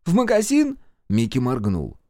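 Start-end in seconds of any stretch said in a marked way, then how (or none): 0.0-0.8 put-on high-pitched little-boy question; hopeful child. A narrator's reading. Микки questions mother, childlike. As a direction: ask as a confused small boy.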